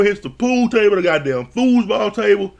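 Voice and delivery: usual speaking voice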